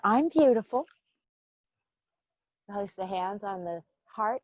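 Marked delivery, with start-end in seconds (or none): 0.0-0.9 playful affirmation. Shown as a demonstration. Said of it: "brush your hair".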